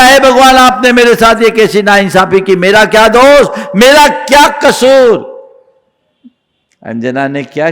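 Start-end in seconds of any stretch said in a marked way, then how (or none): 0.0-5.2 impassioned protest of innocence, swelling at the end; reproachful plea. Acted. Anjana blaming God for her fate, reproachful plea. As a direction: cry out to God as the wronged one. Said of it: "भगवान को दोष दिया".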